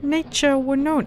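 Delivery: a lad explaining himself; boy voice. Acted. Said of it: "came the reply".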